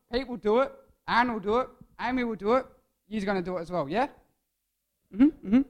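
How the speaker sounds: ordinary talk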